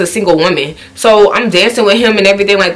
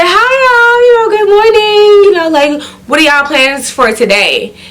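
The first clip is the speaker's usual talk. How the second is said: mock sweet greeting; 12 semitones higher than usual. Sugary fake-friendly morning greeting.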